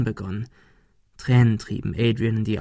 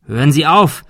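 loud, raised plea to stop; calling out; 4 semitones higher, 6 dB louder than usual. A narrator's reading. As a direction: call out urgently to halt him. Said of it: "rief Thomas". (laut rufend)

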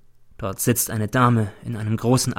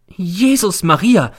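high, loud, drawn-out exclamation of horror; landlady's shocked wail; horrified cry; 8 semitones higher, 6 dB louder, slower than usual. A narrator's reading. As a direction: cry out aghast, slowly. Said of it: "schreit die Hausfrau und wird sehr entsetzt".

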